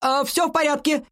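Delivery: high-pitched call to downstairs; shouting back. A narrator's reading. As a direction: call back reassurance across the house. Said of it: "крикнул он в ответ".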